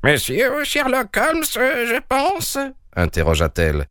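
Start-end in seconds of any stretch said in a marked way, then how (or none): 0.0-2.6 much higher, slow mocking old maid; sardonic old woman. A narrator's reading. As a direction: peer down at him with a sly question. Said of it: "avec un œil sardonique".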